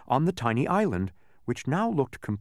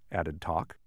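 ordinary delivery, nothing marked

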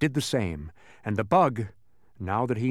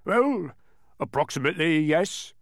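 hedging giant; 5 semitones higher than usual. A narrator's reading. Giant hedging his answer.

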